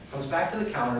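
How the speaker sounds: normal talking voice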